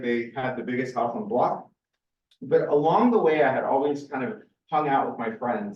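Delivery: usual speaking voice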